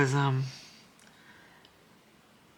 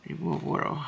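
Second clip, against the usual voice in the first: talking around a paintbrush in mouth; mumbled with a brush in the mouth. Real, not acted. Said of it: "that's me with the paintbrush in my mouth".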